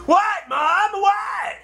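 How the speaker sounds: high indignant what; high mock whine; protesting son. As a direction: play the bewildered teen. Wesley protesting.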